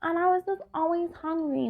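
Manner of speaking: whining high-pitched caricature with stretched vowels; mock whiny quitter. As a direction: imitate a clueless ex-vegan vlogger making excuses. Mimics same vloggers two weeks later.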